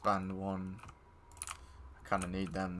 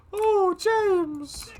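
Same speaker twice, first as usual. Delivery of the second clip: much higher, slow, sing-song mocking coo; silly high voice; 6 dB louder than usual. Gamer teasing friend about playing with James, high mocking coo. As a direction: coo it like a tease winding up a mate.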